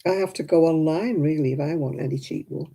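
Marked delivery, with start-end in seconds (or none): none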